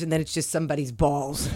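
normal talking voice

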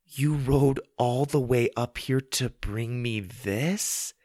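slow, incredulous question; astonished. Acted. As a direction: stretch it out with a rise on the last word. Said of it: "Susie was astonished".

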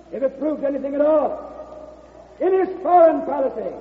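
floor speech. Acted. George addresses the convention against Vandergrift, rising oratory. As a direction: declaim it to the whole hall.

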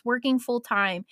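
ordinary delivery

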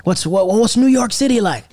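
high, eager questioning voice; curious local. Retold or imitated. European locals quizzing the American, high eager voice. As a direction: mimic the wide-eyed European asking. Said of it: "They would just ask me about Florida".

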